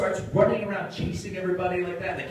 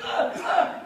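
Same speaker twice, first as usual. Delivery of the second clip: mock cries; 11 semitones higher, 4 dB louder than usual. Neelix crying out.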